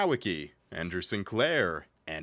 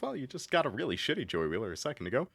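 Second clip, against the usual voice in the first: ordinary talk, nothing marked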